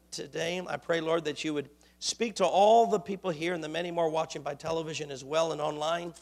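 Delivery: soft, low reverent prayer voice; hushed pulpit prayer. Acted. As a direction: pray quietly before the word. Opening prayer.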